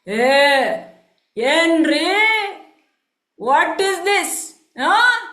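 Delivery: loud, drawn-out indignant questioning; angry demand. Acted. Merchant finds the floor wet, demanding angrily. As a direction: call him out sharply, stretching each word. Merchant scolds coolie again.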